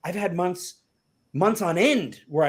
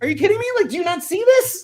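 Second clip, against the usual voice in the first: high, loud indignant mock plea; exasperated disbelief; 7 dB louder than usual. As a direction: demand they see it, half-joking. Masterwork gets no response, exasperated.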